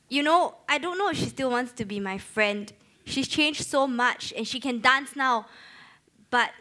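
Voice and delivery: ordinary delivery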